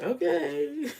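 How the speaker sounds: high, laughing, unconvinced; okay that dissolves into laughter; doubtful okay then a high laugh; laughing skeptical okay. Retold or imitated. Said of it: "They were like".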